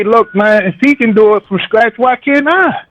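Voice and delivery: usual speaking voice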